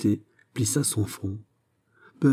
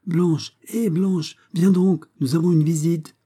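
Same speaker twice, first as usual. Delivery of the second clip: calling upstairs; 8 semitones higher than usual. A narrator's reading. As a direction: call out to the daughter upstairs. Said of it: "il appela".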